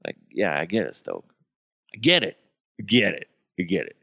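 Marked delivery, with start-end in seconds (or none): none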